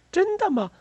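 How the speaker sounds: very high-pitched eager question; excited exclamation. A narrator's reading. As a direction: cry out in surprise, pitch leaping up. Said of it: "菲比叫了起来". (惊喜地尖声叫起来)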